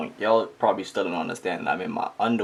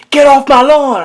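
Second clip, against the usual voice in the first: high, loud yell from the back; mom yelling; 16 dB louder than usual. Retold or imitated. Mom yelling at the girls from the back, loud high yell. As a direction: holler it like an angry mom. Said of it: "mom in the back going".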